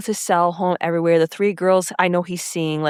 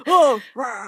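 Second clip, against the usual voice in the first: caveman grunt; 4 semitones higher than usual. Retold or imitated. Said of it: "grunting and just being like".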